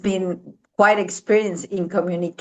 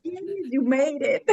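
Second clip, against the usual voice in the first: cheering on; 7 semitones higher, 4 dB quieter than usual. Retold or imitated. Cheering climbers who made it.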